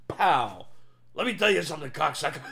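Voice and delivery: a lightly voiced, punchy comic rant; Joey Diaz. Picturing Joey shot in the head, a lightly voiced tough-guy rant. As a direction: toss it off like an unstoppable shot-at comic. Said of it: "he'd still be, like, literally, like".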